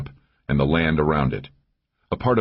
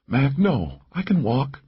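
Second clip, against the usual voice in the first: raised-pitch insistence; wounded companion protesting; 9 semitones higher, slower than usual. A narrator's reading. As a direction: object to being carried. Wounded Laura protests being carried.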